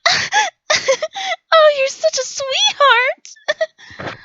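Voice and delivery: very high, slow gushing coo; swooning girl. Retold or imitated. Smitten girls answering his greeting, high coo. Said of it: "Some girls just went".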